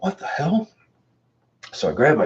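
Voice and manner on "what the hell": high, shocked squeak; startled shriek. Retold or imitated. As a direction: jump out of your skin. Startled by the rocking chair.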